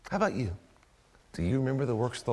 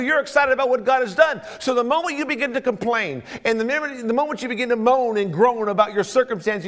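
loud, fervent, quickened preacher's drive; preaching; 12 semitones higher, 9 dB louder than usual. Acted. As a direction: rouse the church to rejoice. Preacher building to full force.

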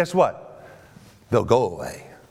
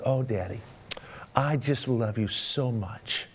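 soft, low and slow sweetness; retold tender affection; 4 semitones lower, 6 dB quieter, slower than usual. Daughter to dad after spanking, hand on his cheek, retold tenderly. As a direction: lovingly imitate a cuddling daughter, hushed.

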